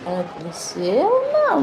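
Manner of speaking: ordinary talk, nothing marked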